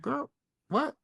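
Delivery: a squeaky stunned "what"; mock incredulous high reply. Boyfriend answering his girlfriend, in a high incredulous squeak.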